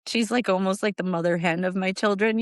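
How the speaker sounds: usual speaking voice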